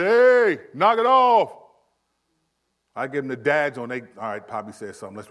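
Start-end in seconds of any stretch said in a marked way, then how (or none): 0.0-1.6 loud, high dad bark; stern yell. Retold or imitated. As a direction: shout them down like a dad. Dad yelling at kids.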